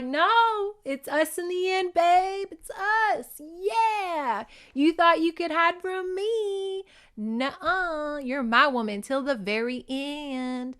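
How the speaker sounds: high, crazed taunting voice, drawn out; imitated gleeful madman. Recapper voicing crazed ex-husband to his captive, gleeful madman. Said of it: "he's just laughing".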